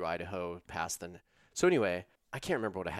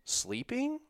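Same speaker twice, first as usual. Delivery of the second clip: puzzled sheepish question. Retold or imitated. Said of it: "I'm like".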